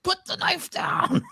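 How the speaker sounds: very high, slow, drawn-out mock scream; panicked shriek imitation. Girlfriend to man still holding knife, mock panicked shriek. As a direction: screech the order as an imitated frightened woman. Frightened wife yells order, retold.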